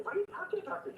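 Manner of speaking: high, incredulous retelling; mock-shocked, why would you say that; retelling his shocked reply to his girlfriend; exasperated retort.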